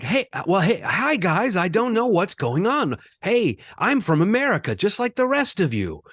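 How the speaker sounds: mock American accent, high and eager; Ewan McGregor's American accent. Mocking an actor's American accent, high and eager. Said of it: "Ewan McGregor's American accent".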